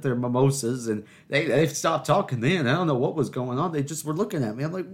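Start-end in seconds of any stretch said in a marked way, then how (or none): none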